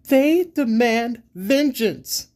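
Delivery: slow, loud, high-pitched fury; seething. A narrator's reading. Siba demanding vengeance from her companions, seething. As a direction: seethe it out slowly, hammering each word. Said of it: "she seethes".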